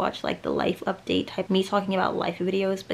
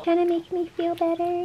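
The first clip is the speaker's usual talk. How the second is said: high cooing to a dog; high-pitched pet talk; 10 semitones higher than usual. Real, not acted.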